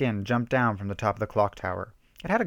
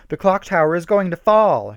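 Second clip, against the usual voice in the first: high, loud warning cry; frantic shriek; 8 semitones higher, 9 dB louder than usual. A narrator's reading. Rachel warns friends the tower is falling, loud shriek. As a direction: scream the warning as it topples. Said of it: "shrieked Rachel".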